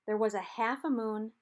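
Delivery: ordinary delivery, nothing marked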